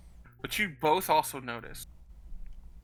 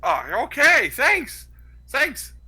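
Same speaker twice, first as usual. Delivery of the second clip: eager thanks; 7 semitones higher, 6 dB louder, much slower than usual. Acted. Thief grabs coin and runs.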